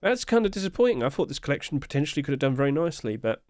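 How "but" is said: hiccuping mid-sentence; hiccups. Real, not acted. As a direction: talk through a hiccup. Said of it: "Sorry for hiccuping".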